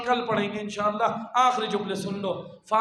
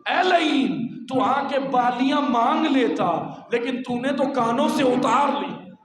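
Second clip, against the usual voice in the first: anguished rebuke; 5 semitones higher, 6 dB louder than usual. Acted. Preacher addressing the one who tore off earrings, anguished rebuke. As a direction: cry shame on the wrongdoer.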